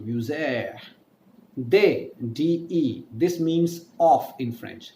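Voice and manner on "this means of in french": ordinary talk, nothing marked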